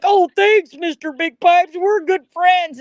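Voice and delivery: high, sugary mock thank-you, slowing at the end; high sing-song sarcastic impression; mocking sweet voice.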